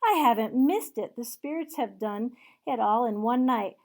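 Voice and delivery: loud and eager; excited calling. Acted. Scrooge calling down to the boy, loud and eager. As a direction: call out to the boy with glee.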